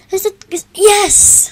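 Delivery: high, loud burst of triumph; excited cheer. Real, not acted. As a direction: shout yes with delight. Guess finally accepted.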